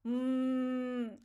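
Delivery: very slow, drawn-out hum; long steady hum; prolonged hum. Shown as a demonstration. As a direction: hold the hum to show pluta. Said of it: "प्लूत मतलब long".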